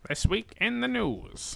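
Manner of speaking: mock 1940s newsreel announcer; hammed-up old radio news voice.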